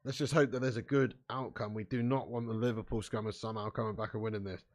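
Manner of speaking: ordinary talk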